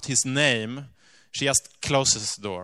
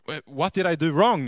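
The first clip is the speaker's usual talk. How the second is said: startled, louder and higher; alarmed question; 4 semitones higher, 6 dB louder than usual. Retold or imitated. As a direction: blurt it out in alarm. Wes alarmed as lady cries.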